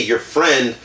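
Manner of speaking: ordinary delivery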